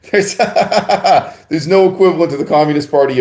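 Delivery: words bubbling through real laughter; laughing while talking. Real, not acted. Laughing at his own joke.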